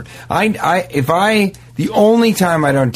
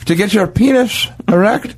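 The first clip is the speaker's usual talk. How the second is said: put-on old-timey salesman; 11 semitones higher than usual. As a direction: sell it like a wagon medicine man. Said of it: "And he goes".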